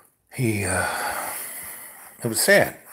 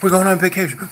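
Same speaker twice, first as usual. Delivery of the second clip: excited Gary; 8 semitones higher, 5 dB louder, faster than usual. Retold or imitated. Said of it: "He goes".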